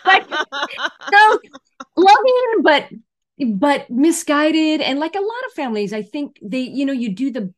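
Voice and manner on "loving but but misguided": high, loud, slowly stretched out words; drawn-out emphasis. Real, not acted. Summing up her parents, drawn out and emphatic. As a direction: lean hard into each word.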